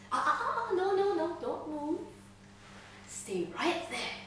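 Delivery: playfully scold, then settle into soft control; patient manic glee. Acted. Captor stops prisoner from moving, gleefully chiding. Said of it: "with a patient, almost manic glee".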